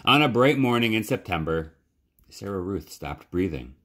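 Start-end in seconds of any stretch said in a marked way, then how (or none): none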